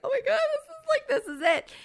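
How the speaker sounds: very high giddy laughing squeal; excited squeal. Retold or imitated. Changing before meeting him, giddy high squeal. Realizing the proposal is happening.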